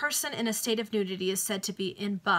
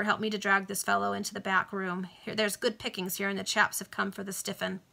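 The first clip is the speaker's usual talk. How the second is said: ordinary delivery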